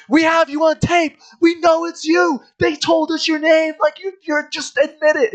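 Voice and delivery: high, loud frantic accusation; hammed-up interrogation; mock grilling. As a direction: hammer the suspect in a squealing voice. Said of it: "they start grilling him".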